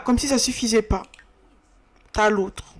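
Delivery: ordinary talk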